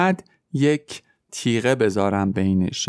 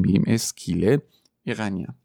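ordinary delivery

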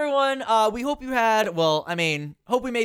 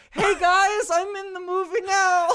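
much higher, louder put-on voice; squeaky mock voice of the guy; mocking impression; 11 semitones higher, 3 dB louder, slower than usual. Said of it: "It was just like".

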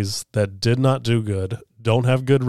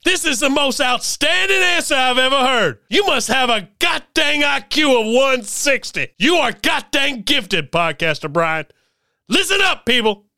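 drill sergeant; 13 semitones higher than usual. Acted. Drill sergeant comedy skit.

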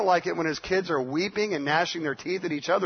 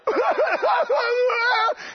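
shrieking laugh. Pretend. Pastor acting out a holy-laughter outburst. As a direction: burst into a wild shrieking laugh.

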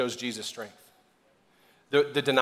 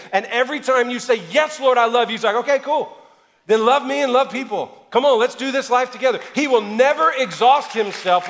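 higher and much louder, driving fervent sermon build; preaching at full force; 8 semitones higher, 9 dB louder than usual. Acted. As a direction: preach it hard to the congregation.

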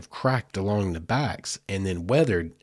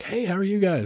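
chirpy high hello to passing hikers; bright high greeting; 11 semitones higher than usual. Retold or imitated. Greeting hikers on the rainy trail, chirpy and high. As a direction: sing out a friendly hi. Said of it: "we're like".

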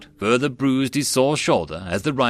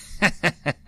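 short acted chuckle, higher than the narration; a quick high chuckle; light laugh; 7 semitones higher than usual. A narrator's reading. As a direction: give a brief, amused character laugh. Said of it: "Jutsu laughed".

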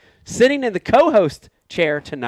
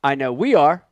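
ordinary talk

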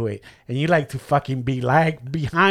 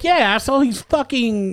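high, louder outburst at the barber; indignant retort; 9 semitones higher, 3 dB louder than usual. Retold or imitated. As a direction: tell him off, voice jumping up. Retells angry retort to careless barber.

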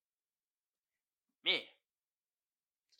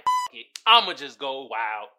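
wild outburst; 2 semitones lower, 9 dB louder than usual. Retold or imitated. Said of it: "He was like".

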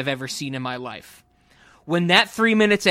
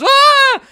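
sudden high loud yelp of alarm; sharp shrieked gasp; startled yelp; 13 dB louder than usual. Retold or imitated. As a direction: yelp out in alarm like a spooked kid. Norman's startled cry retold.

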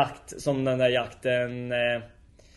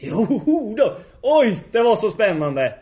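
high, loud exclamations of thrill; hammed-up excitement; 7 semitones higher, 7 dB louder, slower than usual. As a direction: gush it loudly, stretching the words. Gubben återger sin spänning. (överdrivet uppspelt)

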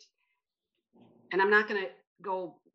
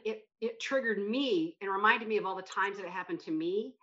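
normal talking voice

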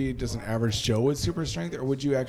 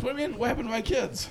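jumps much higher, half-joking shock; mock alarm; 11 semitones higher, 4 dB quieter than usual. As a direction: play it as fake panic about the kids. Mock-alarmed reply to a joke.

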